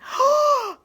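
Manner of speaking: a quick high gasp of shock; startled elephant gasp; sharp gasp. Acted. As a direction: gasp as the egg cracks. Horton hears egg hatching.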